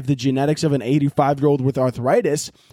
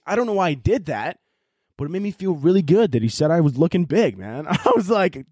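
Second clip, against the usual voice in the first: usual speaking voice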